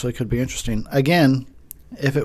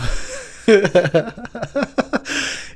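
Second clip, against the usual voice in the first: giggle; 9 semitones higher than usual. Real, not acted.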